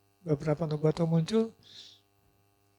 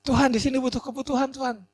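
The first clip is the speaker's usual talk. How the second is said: higher-pitched plea to God; acted plea; 6 semitones higher than usual. Believer tells God of a need, acted pleading.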